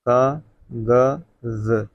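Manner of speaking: कागज drawn out very slowly with a z sound; slow dictation. Shown as a demonstration. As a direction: stretch कागज, marking the nukta. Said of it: "नुक्ता का प्रयोग".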